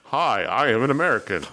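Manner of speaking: British actor badly faking American; mock a stilted attempt at an American accent. Podcaster mocking British TV actors, bad American accent. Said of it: "they do some bad American accents".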